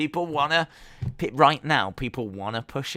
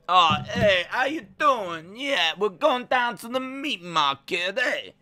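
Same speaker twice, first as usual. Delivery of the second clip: high, hammed-up Italian wiseguy accent; put-on Italian accent; 8 semitones higher, 4 dB louder than usual. Streamer imitating an Italian wrestler for the chat, put-on Italian accent. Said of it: "Daisy's Italian accent sound more like Russian".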